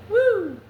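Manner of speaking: high, loud "woo!"; whoop. Real, not acted. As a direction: let out a sudden high whoop. Whoops after own joke.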